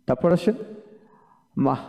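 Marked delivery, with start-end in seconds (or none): none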